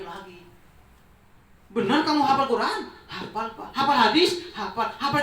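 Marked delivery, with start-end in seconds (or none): 1.7-5.2 amazed retesting. Acted. Father retests son, amazed.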